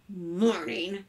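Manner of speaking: terse, subdued grumble; grudging one-word greeting; grumpy. A narrator's reading. Father answering his cheerful son at breakfast, grumpy. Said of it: "said Mister Carmody grumpily".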